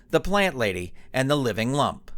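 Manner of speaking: ordinary delivery, nothing marked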